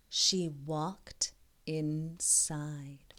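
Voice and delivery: slow, breathy-hushed whisper. A narrator's reading. Ib stepping into the castle, slow hushed whisper. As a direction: creep in with the words. Suspense in the story.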